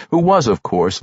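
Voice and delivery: ordinary delivery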